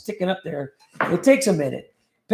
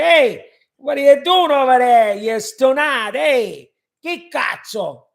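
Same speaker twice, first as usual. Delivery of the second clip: louder, higher Chicago Italian tough-guy voice; 6 semitones higher, 7 dB louder, slower than usual. Retold or imitated. Imitating how folks in the Italian neighborhood talk, in a louder, higher Chicago Italian voice. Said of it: "they talk like that over there".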